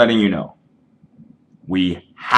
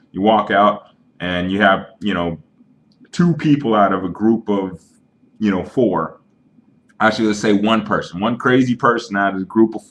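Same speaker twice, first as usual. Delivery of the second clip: ordinary delivery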